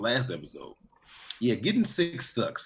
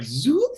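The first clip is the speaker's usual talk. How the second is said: high quick zoop noise; zoop sound effect; 12 semitones higher, 4 dB louder than usual. Retold or imitated. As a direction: make the slide go zoop, up high. Imitates breakdance slide sound.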